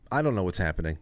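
ordinary talk

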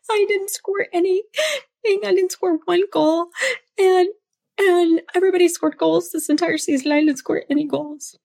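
put-on high, wailing little-girl sob; high teary child voice; mock crying daughter. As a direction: imitate a child crying over her game. Daughter crying after last game.

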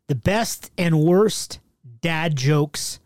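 ordinary delivery

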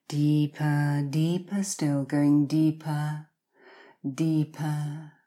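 hypnotic countdown, each number drawn out; slow soothing countdown. Acted. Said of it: "you will hear me count down from ten".

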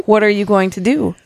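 usual speaking voice

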